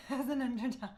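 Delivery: breaking into laughter mid-sentence; laughing. Real, not acted. Streamer asking for help as an underdog, laughing.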